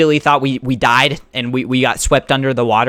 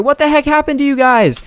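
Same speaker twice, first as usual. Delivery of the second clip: incredulous; 10 semitones higher than usual. Retold or imitated. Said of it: "everyone's like".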